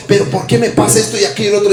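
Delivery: fast mock complaint. Voicing believers' complaint.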